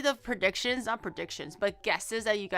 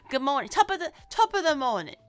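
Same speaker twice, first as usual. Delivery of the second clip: louder, hammed-up mock British "Top of the morning"; attempted British accent; 4 dB louder than usual. Shown as a demonstration. Streamer testing a British greeting for chat in a mock accent. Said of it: "How do you say good morning in, like, a British accent?".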